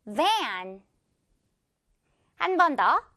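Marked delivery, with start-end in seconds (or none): 0.1-0.8 "van" drawn out, buzzing v; stretched model word. Shown as a demonstration. Teacher asking learners to repeat, stretched demo. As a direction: pull "van" out like a rubber band. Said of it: "듣고 따라해볼까요?".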